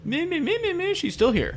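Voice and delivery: high squeal of me me me; eager, high-pitched claiming; excited. Real, not acted. Excited claim on winner pick.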